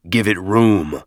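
a touch of a growl; lightly growling chieftain; growled order. A narrator's reading. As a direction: growl a brief command. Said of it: "Maccabeus growled".

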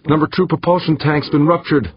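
high, urgent shout; shouted report. A narrator's reading. Pilot reporting damage to captain, high urgent shout. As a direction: keep shouting, pitch well up. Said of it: "the pilot shouted".

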